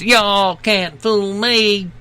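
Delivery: slow, high Southern drawl; hammed-up Southern US accent. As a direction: stretch it out in a put-on Southern accent. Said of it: "tops the survey with Southern accents".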